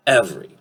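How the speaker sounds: "every" as EV-ree; two-syllable form. Shown as a demonstration. Teacher summing up the word, two-syllable "every" as EV-ree. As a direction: say "every" with the middle e dropped. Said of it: "two syllables".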